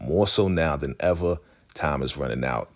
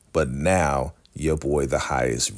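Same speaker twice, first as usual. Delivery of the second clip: ordinary talk, nothing marked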